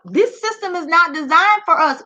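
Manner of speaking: usual speaking voice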